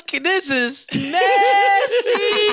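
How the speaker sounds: drawn-out shout. Real, not acted. Reacting in shock to gossip.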